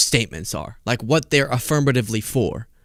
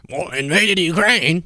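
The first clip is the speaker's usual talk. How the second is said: slow, higher cartoonish mock voice; 4 semitones higher, slower than usual. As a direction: ham up a cartoon narrator. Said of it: "like, a cartoon voice".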